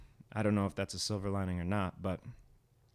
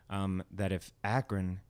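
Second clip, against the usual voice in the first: ordinary talk, nothing marked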